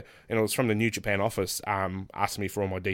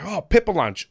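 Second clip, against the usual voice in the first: raised, louder passing remark; retold casual noticing; 8 semitones higher, 6 dB louder than usual. Wrestler spotting Pepper Lunch from the bus, mildly excited. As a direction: point it out with mild excitement.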